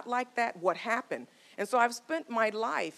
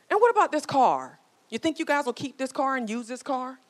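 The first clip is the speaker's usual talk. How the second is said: higher, louder nagging wife; wife's pushy question; 6 semitones higher, 5 dB louder than usual. Retold or imitated. A joking wife pressing her husband about the car, higher and louder. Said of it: "She goes".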